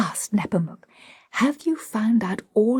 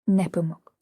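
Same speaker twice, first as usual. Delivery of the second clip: usual speaking voice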